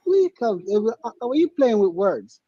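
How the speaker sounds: ordinary talk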